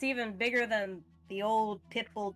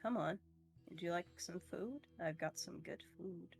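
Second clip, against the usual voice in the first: put-on softer, coaxing old cat voice; 2 semitones lower, 9 dB quieter than usual. Old cat coaxing the kitten out, softly coaxing. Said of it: "the old cat looks at you and is like".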